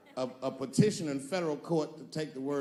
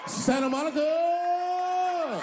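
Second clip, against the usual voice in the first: drawn-out, much higher shout of Santa Monica; 13 semitones higher, 5 dB louder, much slower than usual. Retold or imitated. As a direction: stretch Santa Monica into a long high yell.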